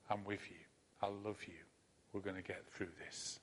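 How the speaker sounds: soft, low, gentle reassurance; tender comfort. Pretend. God comforting a frightened listener, soft and tender. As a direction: hold them close with the voice. God's comforting words repeated.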